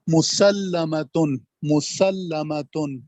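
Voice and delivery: مسلمتن drawn out slowly, with the doubled lam held; slow teaching read of مسلمتن; shadda demo. Shown as a demonstration. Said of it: "لام کے اوپر تشدید ہے".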